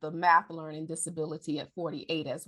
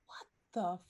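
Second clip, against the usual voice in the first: shocked; 8 semitones higher, slower than usual. Retold or imitated.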